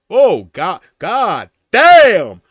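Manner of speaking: big, loud hammed-up cursing; over-the-top shouted dismay; mock exasperated shout. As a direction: holler in exaggerated disbelief. Mock outburst at long messages.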